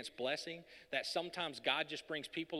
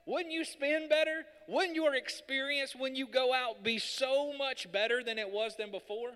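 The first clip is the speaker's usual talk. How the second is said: higher, louder preaching climax; full-force preaching; 8 semitones higher, 8 dB louder than usual. Real, not acted. Pastor building to a climax before the church, loud preaching. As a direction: drive it home to the congregation.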